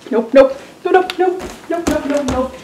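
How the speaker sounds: frantic scared nopes; terrified repeated refusal; panicked. Real, not acted. Panicked denial, creature returns.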